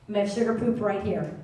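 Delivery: ordinary talk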